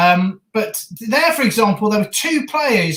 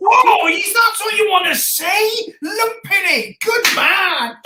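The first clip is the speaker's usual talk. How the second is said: excited; 9 semitones higher, slower than usual. Real, not acted. Celebrating a big clearance.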